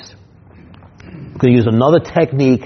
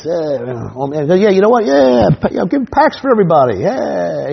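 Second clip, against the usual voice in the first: high swaggering mock big shot; hammed-up show-off, high and cocky; show-off big spender; 6 semitones higher than usual. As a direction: brag like a flashy guy peeling off cash. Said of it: "the guy who likes to go like this".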